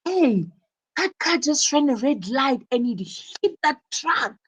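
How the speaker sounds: surprised, energetic. Shown as a demonstration. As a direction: cry out like you just saw a crash. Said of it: "said with a lot of energy".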